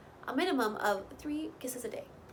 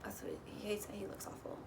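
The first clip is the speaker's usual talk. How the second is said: soft unvoiced whisper, much quieter; whispered aside; 2 semitones lower, 13 dB quieter, faster than usual. Shown as a demonstration. Repeating Kaylin's words to the viewer in a whisper. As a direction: breathe it as a secret aside. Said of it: "whispered repeat of previous line".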